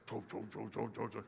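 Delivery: soft chug chug pouring noise; quiet pouring sound effect; imitated pouring sound. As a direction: mimic cereal tumbling out.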